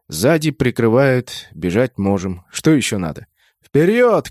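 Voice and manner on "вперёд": high, urgent call forward; short rallying cry, pitched up; shouted command. A narrator's reading. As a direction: shout it as an order. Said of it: "крикнул я".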